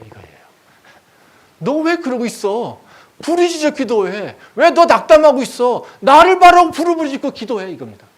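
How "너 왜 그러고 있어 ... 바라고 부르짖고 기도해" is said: loud, high rebuking shout; thundering urgent command; commanding God. Acted. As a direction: rouse the discouraged with a forceful cry. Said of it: "근데 하나님 뭐라고 하십니까?".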